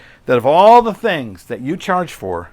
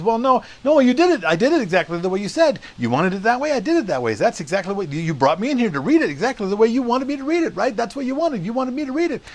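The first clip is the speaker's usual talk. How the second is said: higher pitched, sarcastic mock indignation; berating Shatner; 10 semitones higher, faster than usual. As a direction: rub the engineer's nose in it. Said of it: "berates the guy".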